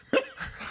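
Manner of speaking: a sudden very high laugh; high squeal of laughter. Real, not acted. Laughing at Mike's confusion, high squeal.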